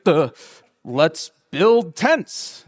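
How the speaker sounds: slow, dim-witted duh; dopey Peter. Retold or imitated. Peter at the transfiguration, slow and clueless. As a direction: drag it out like a clueless guy. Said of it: "the most male response in the Bible".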